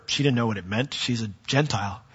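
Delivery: normal talking voice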